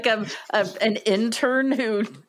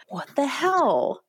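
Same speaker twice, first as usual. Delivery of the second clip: incredulous; 6 semitones higher, slower than usual. Retold or imitated. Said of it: "I'm like".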